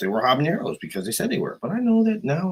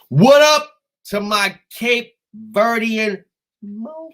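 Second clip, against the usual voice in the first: loud, high, drawn-out greeting; hollered shout-out; 10 semitones higher, 7 dB louder, slower than usual. Real, not acted. Greeting his Cape Verdean side, hollered shout-out. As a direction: call out slowly to the whole clan.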